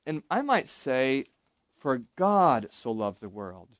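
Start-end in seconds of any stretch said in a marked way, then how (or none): 1.8-3.7 "God" drawn out, rest falling low; stress on God. Shown as a demonstration. Teacher demonstrating emphasis in a verse, stress on "God". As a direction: lean slowly on the word "God". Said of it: "emphasize different words".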